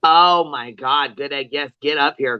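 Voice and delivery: normal talking voice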